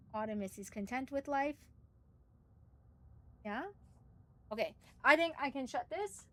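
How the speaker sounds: normal talking voice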